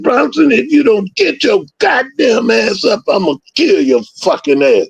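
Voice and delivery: yelling at the quitting dog to get up; retold threat shout. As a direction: bark the threat at Bronson. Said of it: "I said".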